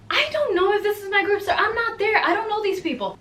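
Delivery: high-pitched, protesting retelling; flustered reply. Retold or imitated. Translator telling the CEO she isn't there, high and flustered. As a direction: pitch it way up, mock-helpless.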